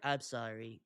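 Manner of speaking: small, soft, low sorry; shrinking apology. Retold or imitated. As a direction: shrink away as you apologize. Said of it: "and shrink in".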